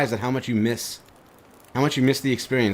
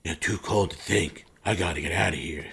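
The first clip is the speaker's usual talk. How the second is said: alpha male voice; 5 semitones lower, 6 dB quieter than usual. Retold or imitated. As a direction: play the tough video game guy. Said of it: "generic video game alpha male voice".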